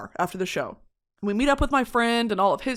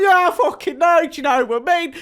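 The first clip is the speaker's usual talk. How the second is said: loud, higher Cockney comeback; mock British accent; 5 semitones higher, 6 dB louder than usual. Woman flirting back at British guy in mock Cockney accent. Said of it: "I'm throwing the accent back to him".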